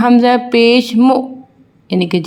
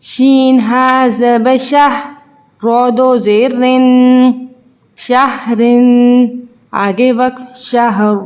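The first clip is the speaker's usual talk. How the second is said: put-on drawn-out sing-song spelling drill for children; teacher slowly spelling out Arabic syllables for young pupils; slow letter-by-letter recitation; 4 semitones higher, much slower than usual. Shown as a demonstration. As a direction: chant each letter and vowel slowly, as in a Qaida lesson.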